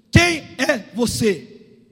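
loud, slow, separated words; thundering question. Acted. God asking Jacob who he is, thundering question. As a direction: hammer out each word as a demand.

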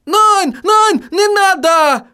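high panicked German shout. A narrator's reading. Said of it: "крикнул Отто".

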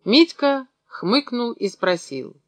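plain reading voice

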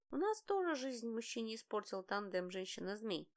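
ordinary delivery, nothing marked

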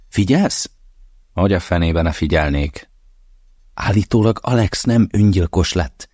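a lightly voiced, urgent mother bringing news; mother's voice. Mother phones her hungover son with gossip, lightly voiced and urgent. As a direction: lean in, she needs him to listen.

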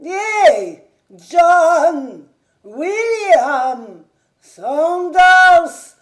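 put-on high, loud old servant's call. A narrator's reading. Old servant calling the absent grooms, loud high shout. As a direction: shout for grooms who are not there.

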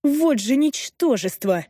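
high, slow outraged outburst; exploding in anger. A narrator's reading. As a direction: burst out in contempt. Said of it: "взрывается Эйден".

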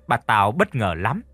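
usual speaking voice

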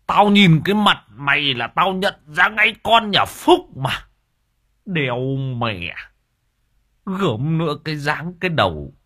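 grind out the accusation in fury; angry old man. A narrator's reading. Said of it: "Ông nghiến răng kèn kẹt". (ông già nghiến răng giận dữ)

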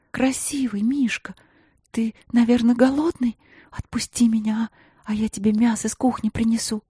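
high, whispered, fearful coaxing; terrified pleading hostage. Acted. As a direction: coax the beast while shaking. Coaxing the bear in fear.

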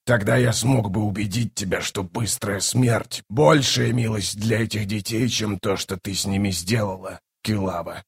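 low, soft reproach; low, hushed sorcerer voice; sombre bone caster. Acted. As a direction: speak quietly and gravely to her.

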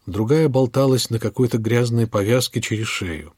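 ordinary delivery, nothing marked

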